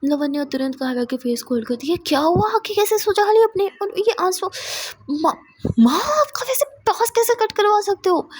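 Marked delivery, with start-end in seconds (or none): 2.0-8.3 high, loud worried outburst; high, loud alarm at mother. Acted. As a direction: rush the questions up high in shock. Sees friend crying, confronts mother.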